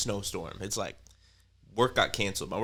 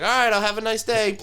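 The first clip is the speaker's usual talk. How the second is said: high, louder mock-sweet sign-off; fake-cheery brush-off; 13 semitones higher, 9 dB louder than usual. Ending the call with the office, high mock-cheery farewell. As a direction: hang up with exaggerated cheer. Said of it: "I was like".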